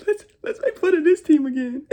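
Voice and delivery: loud, high, laughing taunt; mocking rival fans. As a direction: cackle it out like a gloating rival fan. Mimicking rival fans mocking his team.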